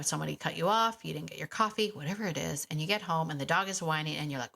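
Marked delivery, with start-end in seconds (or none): none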